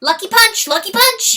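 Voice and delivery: high loud weasel warning; warned. Acted. Weasel warning the fawn, high and loud. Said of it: "he warned her".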